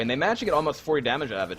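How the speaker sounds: ordinary talk, nothing marked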